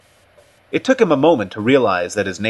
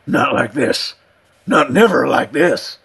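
hoarse, higher-pitched protest; frightened old man; 5 semitones higher, 4 dB louder than usual. Acted. Cut and Shoot answers Richardson's dismissal, frightened. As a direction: protest in an old-timer's alarmed voice.

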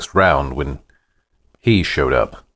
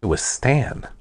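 usual speaking voice

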